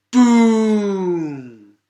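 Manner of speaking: loud dramatic boom. A narrator's reading. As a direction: explode the word. Asteroid impact.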